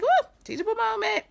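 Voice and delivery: high, loud gleeful exclaim; woo, teachable moment. Retold or imitated. To her viewers, flipping a moment with a high, loud whoop. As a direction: sing out the discovery with a whoop. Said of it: "I will flip a situation so quick and be like".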